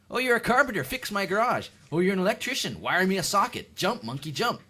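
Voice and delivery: high, loud mock-eager orders; rapid-fire list of put-on commands; pushy demands. Guest ordering tradesmen about like monkeys, high loud mock demands. Mocking pushy requests, building rant.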